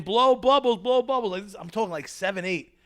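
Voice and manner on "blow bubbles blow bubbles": high, louder coaxing repeat; swim teacher coaxing. Retold or imitated. Swim teacher to little boy, coaxing. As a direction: coax it like a swim teacher to a child.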